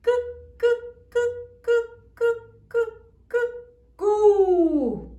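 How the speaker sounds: high sharp "cuc" repeated then "Cu"; high repeated K sound. Shown as a demonstration. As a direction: chirp "cuc" over and over, high, then land on "Cu". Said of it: "pronunciamos el sonido de esta letrita". (repite "cuc" agudo y termina en "Cu")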